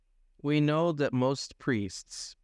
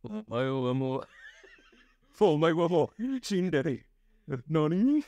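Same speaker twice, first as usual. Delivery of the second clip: mock anime voice.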